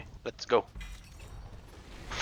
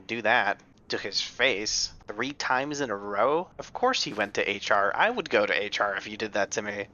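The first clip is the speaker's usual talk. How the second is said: usual speaking voice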